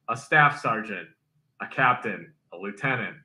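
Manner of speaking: ordinary talk, nothing marked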